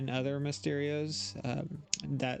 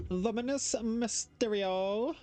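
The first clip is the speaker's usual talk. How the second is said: high, drawn-out title announcement; dramatic title read; 8 semitones higher, slower than usual. Acted. Reactor announcing the episode title to viewers, dramatic. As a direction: stretch the episode title out with mock grandeur.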